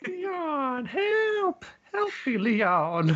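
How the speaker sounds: high, loud, slow cry for help, with a laugh; Ashley's cry. Retold or imitated. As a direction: shriek for Leon like a helpless damsel.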